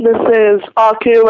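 ordinary talk, nothing marked

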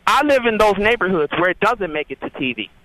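usual speaking voice

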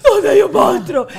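high, loud, slow mock wail; frightened child. Mother imitating her daughter scared of a monster, wailing. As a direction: panic like a tearful little girl.

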